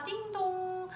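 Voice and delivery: high, slow ding-dong; sing-song chime imitation. Mother distracting child with the waiting-room chime, sing-song. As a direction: stretch it out like a bell.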